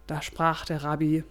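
ordinary delivery, nothing marked

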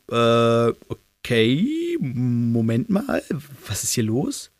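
drawn-out, hesitant confusion; bewildered. A narrator's reading. Everyone baffled as the professor bursts in, bewildered. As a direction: stretch each word out in baffled disbelief.

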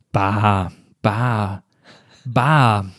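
low, slow, puzzled repeating of "Bar"; dazed repetition. Retold or imitated. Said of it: "Wenn man ein Wort ganz oft sagt".

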